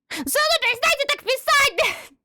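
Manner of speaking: high, loud yell at chat; real exasperated shout. Real, not acted. Yells at chat.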